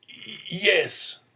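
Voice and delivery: put-on halting, high-pitched old man's stammer. A narrator's reading. Old father confessing to son, stammering. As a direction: stumble on the first sound before admitting it. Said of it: "stammered the old man".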